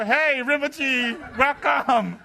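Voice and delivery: very slow, high, loud greeting; drawn-out devotee welcome. Retold or imitated. Imagined fan drawls a welcome to Rinpoche, slow and high. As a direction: stretch it out like a gushing fan.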